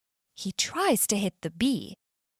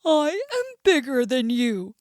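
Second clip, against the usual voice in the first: annoyed bear; 4 dB louder than usual. Acted. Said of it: "Mister Bear is annoyed".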